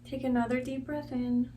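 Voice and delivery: soft, unhurried meditation guide voice; guided meditation. Real, not acted. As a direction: guide them gently through each breath, quietly. Leads a guided breathing meditation.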